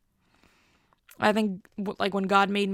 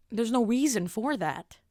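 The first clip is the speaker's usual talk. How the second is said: usual speaking voice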